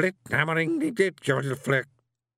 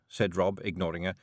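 ordinary delivery

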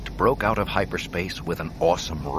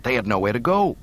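ordinary delivery

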